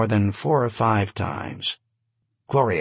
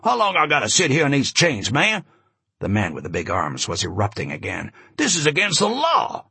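ranting chained witness; 3 semitones higher than usual. Acted. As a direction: yell it at the cop, outraged. Chained witness erupting again.

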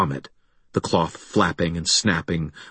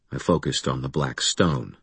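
ordinary delivery

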